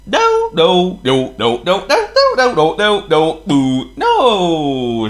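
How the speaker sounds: high, loud teasing refusals; naughty trucks. Acted. Trucks refusing Thomas naughtily. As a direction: tease like naughty children. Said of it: "said the sharks naughsily".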